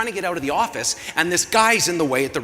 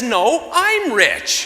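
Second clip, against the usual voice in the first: "I'm" stretched very slow and loud, higher; gloating stress; 7 semitones higher, 7 dB louder, much slower than usual. Acted. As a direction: lean hard on "I'm", drawn out loud and long. Wife corrects husband, gloating.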